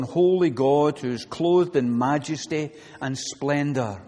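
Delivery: ordinary talk, nothing marked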